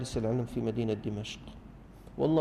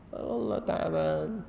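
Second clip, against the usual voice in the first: whiny mock excuse; 8 semitones higher, slower than usual. Mocking a lazy young man's excuse.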